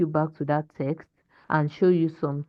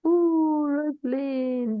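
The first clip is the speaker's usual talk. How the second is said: long high 'ooh', trailing down; dismayed; 9 semitones higher, much slower than usual. Real, not acted. Teacher to pupil who got it wrong, dismayed. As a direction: groan the name out slowly in dismay.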